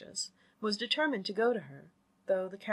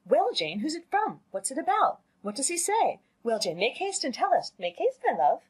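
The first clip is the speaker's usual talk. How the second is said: high, louder, impatient questioning; eagerly calling out; 8 semitones higher, 5 dB louder than usual. A narrator's reading. Mother pressing her daughter about the note, eagerly calling out. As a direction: fire off eager questions, pitch leaping up. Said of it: "she was eagerly calling out".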